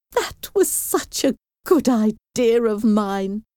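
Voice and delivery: high, boastful fussy mother; self-satisfied mother. Acted. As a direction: crow over your own cleverness. Mrs Bennet congratulating herself.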